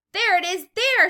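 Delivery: very high, loud pointing shout. A narrator's reading. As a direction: yell and point it out. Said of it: "someone else shouted".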